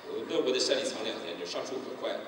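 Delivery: normal talking voice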